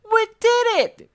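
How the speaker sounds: very high, loud triumphant cry; excited cry. A narrator's reading. Elmo celebrating the lights, high and loud. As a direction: cheer it out. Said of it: "cried Elmo".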